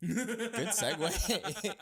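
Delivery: real cracking up, words stretched out high and slow; helpless high-pitched laugh on the words. Real, not acted. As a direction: repeat it through high, loud laughter. Cracking up at co-host's segue.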